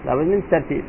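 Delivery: ordinary talk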